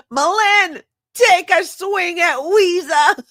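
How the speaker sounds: very high, loud hammed-up holler; shrieked movie impression, stretched out; movie-quote yell. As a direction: belt out the film line, slow and high. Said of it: "what's her name yells".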